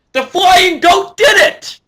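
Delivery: loud, high, slow cheer; victory shout. Real, not acted. Fan cheering Daniel Bryan's win to viewers, loud shout. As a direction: shout it like the crowd is with you.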